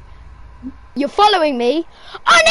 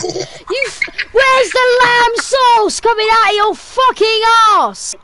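hammed-up angry celebrity chef yell; Gordon Ramsay yelling; 7 semitones higher than usual. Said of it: "Gordon Ramsay yelling".

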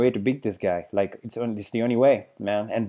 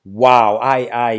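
normal talking voice

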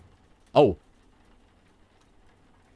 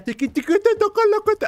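sing-song; 4 dB louder than usual. Pretend. Teasing the baby.

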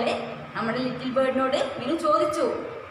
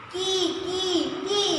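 bird chirp; 4 semitones higher, much slower than usual. Shown as a demonstration. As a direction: peep gently like a frightened chick. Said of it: "little bird എന്തോ പറയുകയാണ്".

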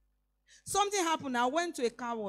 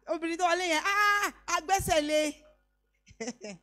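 loud, slow teasing call in Yoruba; playful mock complaint, loud and drawn out; jokingly; 6 semitones higher, 7 dB louder, slower than usual. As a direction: drag out the joke as if teasing. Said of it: "Jokingly on a lighter mood".

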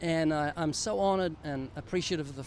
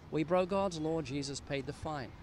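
ordinary talk

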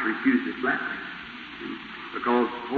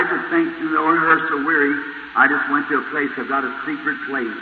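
ordinary talk

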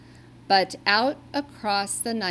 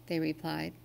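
plain reading voice

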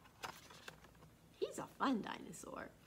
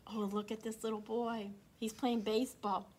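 plain reading voice

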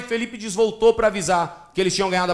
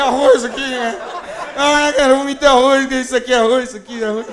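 put-on a drunk man finding rice in his vomit; 3 semitones higher than usual.

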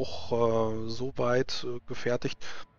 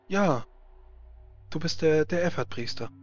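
excited woman voice; 4 semitones higher than usual. Acted.

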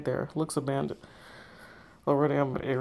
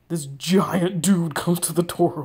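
dramatic reveal; 8 dB louder, slower than usual. Acted. [name] describes the killer appearing.

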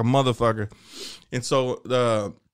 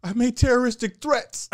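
put-on bragging kid; 10 semitones higher than usual. Thirteen-year-old kid answering.

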